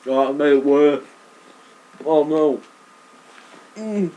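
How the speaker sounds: loud, high, pained cry; pained wail. Real, not acted. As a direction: moan through the burn. Mouth burning from leek.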